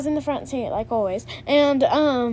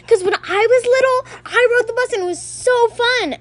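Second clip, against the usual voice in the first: high, louder nostalgic gushing; gushing mom; 9 semitones higher, 4 dB louder than usual. Retold or imitated. Mom remembering the bus to her kids, high gushing. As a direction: oversell the bus ride. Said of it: "you were like".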